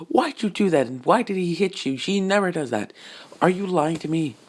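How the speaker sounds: high, disbelieving motherly scold; a mom demanding answers. Acted. Mother questioning her son about the fight, high scolding voice.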